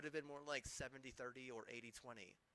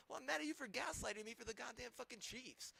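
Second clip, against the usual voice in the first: pitched way up, voice climbing in outrage; real indignant rant, pitch soaring; indignant outburst; 10 semitones higher, 3 dB louder than usual. Real, not acted. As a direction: fire it back at them, incredulous and high.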